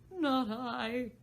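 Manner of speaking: lightly mournful hatter. Acted. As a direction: shake your head sadly as the Hatter. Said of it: "shook his head mournfully".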